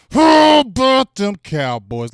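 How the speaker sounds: drawn-out team cheer; slow drawn-out shout. Real, not acted. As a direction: stretch the cheer out like a chant. Hype chant repeated.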